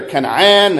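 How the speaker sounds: Canaan said as ka-NAAN; short A in Canaan, like "can", then a second open A; alternate pronunciation. Shown as a demonstration. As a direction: say Canaan as KAN-ah-an. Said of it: "doesn't matter what you call it".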